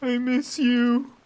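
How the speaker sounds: high, drawn-out mock wail of grief; theatrical, high-pitched mourning cry, slowed right down; mock lament. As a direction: ham up the heartbreak for a lost friend.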